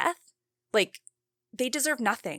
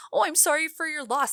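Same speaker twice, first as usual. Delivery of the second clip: high, sing-song mock of a hollow apology; mocking imitation; 7 semitones higher, 3 dB louder than usual. Host mocking the killer's courtroom apology, in a high sarcastic voice. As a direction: sneer it out, parroting her empty words. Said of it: "you don't take a single ounce of accountability".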